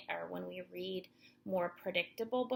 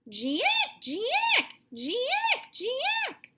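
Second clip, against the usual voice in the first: high, louder jeek; monkey screech; 10 dB louder than usual. Acted. Reading to children, high monkey calls.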